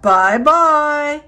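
loud, big bear farewell; big gruff voice. A narrator's reading. Big bear calling goodbye to the girl, gruff. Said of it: "gruff voice".

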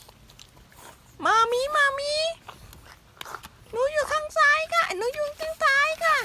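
put-on very high squeaky baby voice; cute baby voice. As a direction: squeak it like a tiny pet. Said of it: "ลูก".

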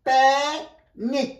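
Panic drawn out slowly, প্যা held long, then নিক; split into syllables. Shown as a demonstration. Teacher showing a two-syllable word, panic stretched into syllables. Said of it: "দুটো অথবা তিনটে syllable".

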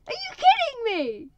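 very high, very loud, slowed outrage; outraged. Real, not acted.

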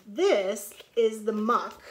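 loud, slow storybook line; emphatic read-aloud; reading to children. Acted. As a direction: read it big for the little ones. Reads picture book aloud.

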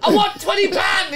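high-pitched, laughing mock demand; mock old Indian man. Retelling the old man's demand for money, high mock voice. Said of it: "He's saying".

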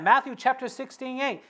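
normal talking voice

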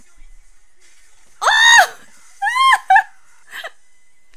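very high screaming laughter; laugh-scream in delight; shrieking laugh. Real, not acted.

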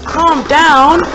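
loud and high, yelled at the arena; shouting at the battling tops to settle; shouted calm down. Real, not acted. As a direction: bark it at the spinning top. Yelling at the tops.

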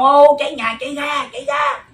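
loud, high cry of fire; frantic shout warning neighbours; shouting fire alarm. Retold or imitated. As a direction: yell it out like the house is burning. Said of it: "Không la sao chạy ra".

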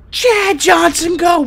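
high, drawn-out cheer; excited high-pitched urging. Real, not acted. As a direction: call the runner on, high and slow. Cheering on a running player.

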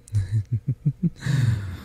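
long, soft chuckle trailing off; hushed flirty laugh; soft giggle. Acted. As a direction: giggle quietly to yourself. Flirting over cuddling.

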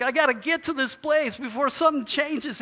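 urgent excitement. Acted. Tenant grabbing the new address, excited and urgent. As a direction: blurt it like you must go now. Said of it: "take the address really quickly".

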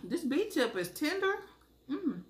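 talking with food in mouth; mumbled through a bite; chewing while talking. Real, not acted. Seen 0: Holds beef piece on fork; lips work closed, chewing between words.

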